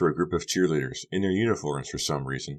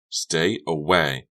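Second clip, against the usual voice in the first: very slow hard command; clenched warning; 4 dB louder, much slower than usual. Acted. Becca repeats her warning to Honey, very slow. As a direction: spell it out slowly.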